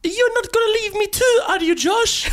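put-on high-pitched, botched French accent mistaken for Indian; wild foreign accent. Actor auditioning as Josh pleading with Maya, wild accent. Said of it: "That's a wild accent".

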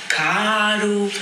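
fake Rio accent. Shown as a demonstration. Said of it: "fazer o meu sotaque brasileiro".

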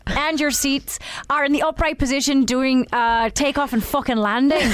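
Irish flight attendant reading the safety spiel; playful Irish cabin announcement; Irish accent. Acted. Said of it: "Now Irish".

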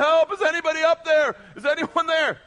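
high, loud cry from a man dangling off a cliff; desperate yell for help. Retold or imitated. Man hanging from a cliff yelling up, loud and high. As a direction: call up frantically for help. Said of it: "hanging onto a branch yelling".

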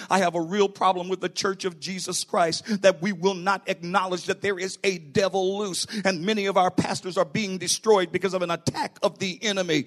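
ordinary talk

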